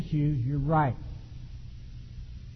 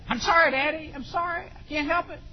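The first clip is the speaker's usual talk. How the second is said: daughter's apology; 11 semitones higher than usual. Retold or imitated. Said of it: "It was that".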